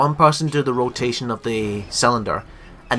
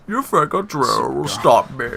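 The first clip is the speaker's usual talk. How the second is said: slow, raised-pitch villain taunt; zombified Bane; 4 semitones higher, slower than usual. Acted. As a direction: taunt like a hammed-up movie villain. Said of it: "a zombified Bane".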